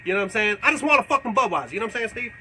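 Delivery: ordinary talk, nothing marked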